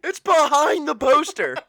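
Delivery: loud, high alarm; shocked discovery. Pretend. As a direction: gasp at the find. Acted discovery.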